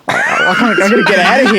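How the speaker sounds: half-laughing mock meltdown; laughing panic. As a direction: act out being overwhelmed through laughter. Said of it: "I'm like".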